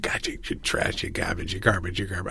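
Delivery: low, hushed Brooklyn grumble of abuse; Brooklyn construction worker. Acted. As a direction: mutter insults like a Brooklyn hard hat. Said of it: "sounds like a Brooklyn construction worker".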